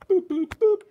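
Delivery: high, loud boops like a machine; beeping sound effect. Pretend. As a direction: chirp it like a robot beeping. Imitated beeping noise.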